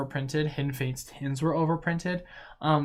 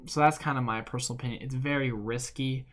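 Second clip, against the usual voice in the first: normal talking voice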